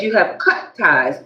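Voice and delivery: usual speaking voice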